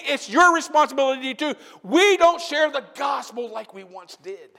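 loud, high, impassioned sermon shout; full-force preaching. Real, not acted. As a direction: preach it hard to the congregation.

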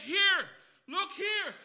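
slow, loud, high call for attention; commanding call. Acted. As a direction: demand they look, drawn out. Spirit commanding, voiced loudly.

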